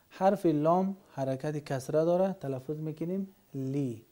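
ordinary talk

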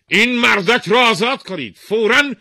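loud, high royal commands; king shouting to fetch the pigeon keeper. Acted. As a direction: bellow orders for justice. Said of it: "پادشاه با فریادی بلند".

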